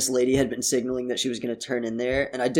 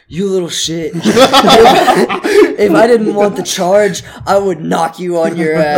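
loud, laughing tough-guy threat; mock angry threat; 4 semitones higher, 7 dB louder than usual. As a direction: growl it like a bully, laughing. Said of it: "he's like".

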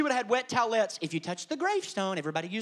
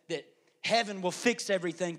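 ordinary talk, nothing marked

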